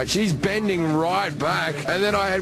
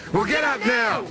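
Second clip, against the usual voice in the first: lightly imitated angry mother, higher pitched; retold motherly order, raised in pitch; mock bossy mum; 6 semitones higher than usual. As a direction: act out a mum's exasperated command. Mother ordering son in story.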